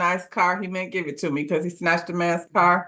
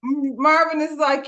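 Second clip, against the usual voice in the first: ordinary talk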